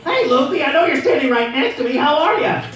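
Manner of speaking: high, loud voice with a hitch; Usopp voice. Shown as a demonstration. Voice actor shows fans Usopp's loud high hitch. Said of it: "that hitch that Usopp has in his voice and the volume".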